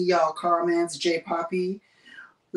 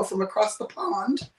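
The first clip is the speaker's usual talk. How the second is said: badly imitated British accent, pitched up; awful British accent; 4 semitones higher than usual. Shown as a demonstration. Streamer teasing a viewer from the UK, awful British accent. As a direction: have a playful, clumsy go at a British accent. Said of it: "an awful, uh, imitation of accent".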